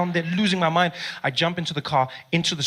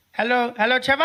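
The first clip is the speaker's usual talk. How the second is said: little brother voice; 7 semitones higher than usual. Retold or imitated. Baby brother calls Trevor on mom's phone, high kid voice. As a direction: play the polite nine-year-old.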